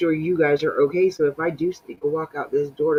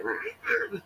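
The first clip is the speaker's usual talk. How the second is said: imitated cat growl; 9 dB quieter than usual. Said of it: "and this cat was like".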